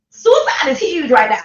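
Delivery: emphatic loud warning. Real, not acted. Vlogger urging viewers about suicide risk, loud and emphatic. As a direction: hit it hard so they hear the danger. Stressing danger to grieving people.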